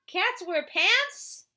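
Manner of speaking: high, loud, very slow disbelief; mock surprise. Reader marvels to the child, high and slow. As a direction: stretch it out in amazement.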